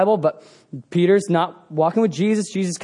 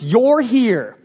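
ordinary talk

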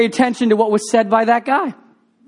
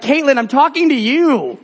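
retold pointed appeal, pitched higher; mock-exasperated call to get her attention; raised pitch, singling someone out; 5 semitones higher than usual. Preacher pleading directly with inattentive teen.

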